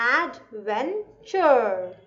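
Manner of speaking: adventure said very slowly, syllable by syllable; slow, drawn out. Shown as a demonstration. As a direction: stretch out adventure slowly for the class. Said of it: "adventure को भी तोड़ते हैं". (adventure धीरे-धीरे खींचकर बोलना)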